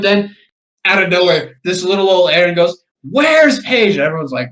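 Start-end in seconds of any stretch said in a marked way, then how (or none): 3.0-3.9 high, loud imitated holler; retold yell. Angry woman searching a packed bar, yelling. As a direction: call it out sharply, imitating someone hunting Paige.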